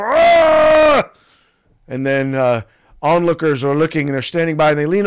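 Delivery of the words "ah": high sudden yelp; a hammed-up shocked gasp; mock startled cry. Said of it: "the attacker stop and go".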